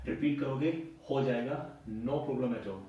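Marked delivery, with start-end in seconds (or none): none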